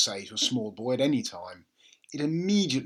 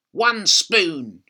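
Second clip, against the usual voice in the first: put-on higher, louder bossy old woman; bossy grandma; 5 semitones higher, 9 dB louder than usual. A narrator's reading. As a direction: order the boy about. Grandma voiced in read story.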